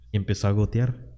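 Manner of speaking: ordinary delivery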